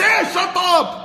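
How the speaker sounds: mock yelled hush; dismissive shout. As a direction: snap them into silence. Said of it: "they say".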